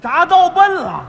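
loud, high, drawn-out repeat; astonished echo. Acted. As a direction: stretch the punchline out in mock amazement. Partner echoes the punchline theatrically.

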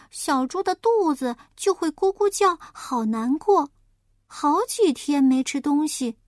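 high little pig, full of pity; a piglet feeling for the wolf cubs; sympathetic piglet. Acted. Piglet sympathizes with the wolf, high voice.

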